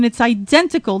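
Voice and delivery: usual speaking voice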